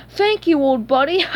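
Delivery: high, loud gleeful thanks; delighted. A narrator's reading. Plankton handed the secret formula, delighted. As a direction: crow your thanks. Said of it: "Delighted, Plankton said".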